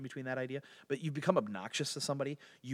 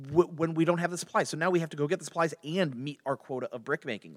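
higher, pleading protest; acted-out aggrieved appeal, raised in pitch; crying out in protest; 6 semitones higher, 5 dB louder than usual. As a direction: plead indignantly with the ruler. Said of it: "they went and cried out to Pharaoh".

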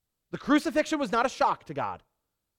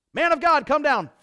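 loud, high shout of a captain; commanding summons; 6 semitones higher, 6 dB louder than usual. Retold or imitated. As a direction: call him down from the hill. Soldier summons Elijah.

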